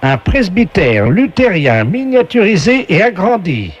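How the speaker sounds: slow, booming quiz-show host; game show announcer voice. Acted. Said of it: "la voix du jeu".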